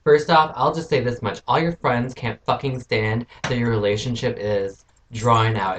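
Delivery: normal talking voice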